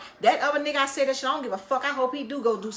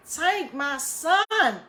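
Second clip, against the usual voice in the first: higher, slow anguished cry, half-hearted; retold anguished scream; 5 semitones higher, 3 dB louder, much slower than usual. Mother crying out for her son, a mild slow wail. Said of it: "when she screamed, she said".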